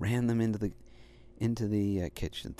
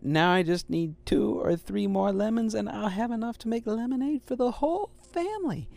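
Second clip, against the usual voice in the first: put-on higher, eager young puppy voice; Little Dog voice; 10 semitones higher, 4 dB louder than usual. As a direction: play the hopeful little dog. Little Dog's voice in story.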